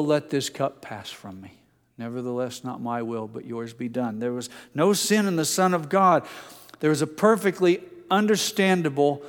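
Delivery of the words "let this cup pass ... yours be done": low, soft, submissive prayer; hushed prayer. Acted. Jesus prays to the Father in Gethsemane, hushed and yielding. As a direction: pray it quietly, letting the pitch sink.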